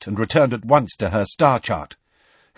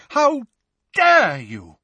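high, indignant cry with a break after "How"; outraged shout; 11 semitones higher, 4 dB louder, slower than usual. A narrator's reading. Teacher running at the attackers, outraged shout. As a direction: shout each part apart, outraged. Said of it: "the figure shouted as she ran".

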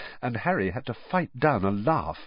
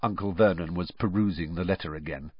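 plain reading voice

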